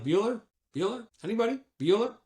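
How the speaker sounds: playful roll call to a silent room; teacher roll call. Retold or imitated. Roll-call joke on silent customer.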